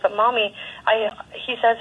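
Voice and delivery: normal talking voice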